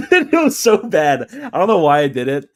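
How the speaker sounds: high, loud, helpless laughter through the words; laughing hard. Real, not acted. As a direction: crack up while confessing. Cracks up at own story.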